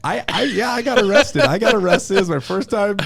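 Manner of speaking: high, loud laughing through it; laughing admission. Real, not acted. Laughing as he tells the host about his arrest. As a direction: laugh while you confess.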